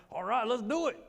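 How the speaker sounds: loud, high gung-ho cheer; motivated. Retold or imitated. Patient greeting the physical therapists, gung-ho and motivated. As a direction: psych yourself up for the workout. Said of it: "I'm trying to be motivated".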